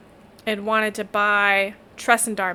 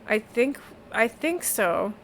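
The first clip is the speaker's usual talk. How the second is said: put-on uneasy young man; 3 semitones higher, 5 dB quieter, slower than usual. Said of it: "And he's like".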